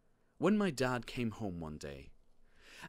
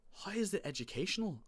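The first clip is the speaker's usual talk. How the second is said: high, quick alarmed question; terrified; 10 semitones higher, faster than usual. A narrator's reading. Boy dreading the library, alarmed high question. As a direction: ask it high and fast, mildly alarmed. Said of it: "I asked, terrified by the idea".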